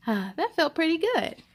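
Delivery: usual speaking voice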